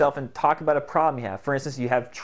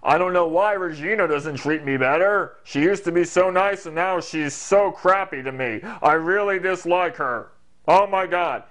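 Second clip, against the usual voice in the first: louder, higher put-on gripe; funny voice; 4 semitones higher, 4 dB louder than usual. Shown as a demonstration. Venting about Regina, funny pushed-up voice. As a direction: push up into a silly indignant character voice. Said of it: "You can talk like this".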